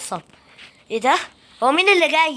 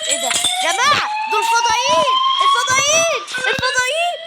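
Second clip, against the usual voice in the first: very high-pitched squeal of alarm; panicked shriek; 13 semitones higher than usual. Real, not acted. Player spotting aliens and warning viewers, panicked shriek.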